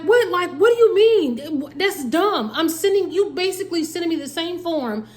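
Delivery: retold frustrated outburst. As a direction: push back in disbelief, pitch up. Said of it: "I'm frustrated".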